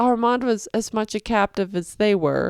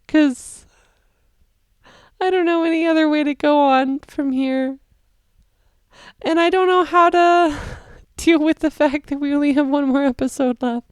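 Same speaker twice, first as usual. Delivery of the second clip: high, loud, on the edge of crying; real overwhelmed tearful wail; 8 semitones higher, 6 dB louder than usual. Real, not acted. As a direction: let it break into half-laughing tears. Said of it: "I'm gonna start crying again".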